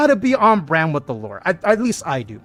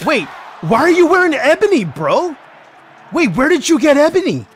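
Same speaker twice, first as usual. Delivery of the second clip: high, loud cheated gamer outrage; indignant gamer yelling; outraged yell; 11 semitones higher, 8 dB louder than usual. Real, not acted. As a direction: shout at the cheater in disbelief. Outraged at opponent in game.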